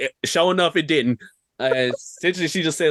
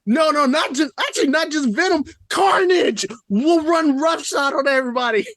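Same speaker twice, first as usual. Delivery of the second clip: heated excitement; 10 semitones higher than usual. Real, not acted. Heated fan argument about Venom.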